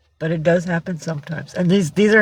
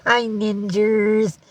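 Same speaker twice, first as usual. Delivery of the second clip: slow, high singsong greeting; greeting passed to the cat; 6 semitones higher, much slower than usual. Pretend. Owner passing a viewer's hello to her cat, singsong. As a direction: pitch it up and stretch it out sweetly. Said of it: "Cat says".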